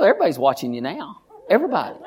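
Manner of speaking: normal talking voice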